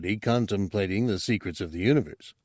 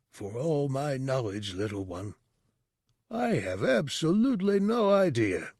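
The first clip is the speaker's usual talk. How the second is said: distant voice, rising high at the end; amused old teacher; 4 semitones higher, 4 dB quieter than usual. Acted. The teacher tells his student he has no idea, wry and distant. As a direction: admit it with a wry lift.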